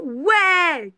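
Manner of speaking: team cheer. Acted. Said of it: "Crie l'équipe".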